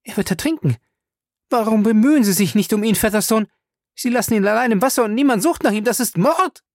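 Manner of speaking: high, rushed, agitated accusation; alarmed. A narrator's reading. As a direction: panic rising into a loud reproach. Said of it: "Sie wurde wieder unsicher".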